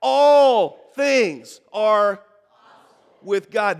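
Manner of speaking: loud, slow, emphatic declaration; like you mean it. Shown as a demonstration. Pastor leads the congregation in a recitation, loud and emphatic. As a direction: proclaim every word with full conviction. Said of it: "We're gonna say it again".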